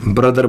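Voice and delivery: ब्रदर with the final R sounded; common wrong pronunciation. Shown as a demonstration. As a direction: say ब्रदर as "bra-dar", R included. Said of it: "हमारा बिल्कुल गलत है".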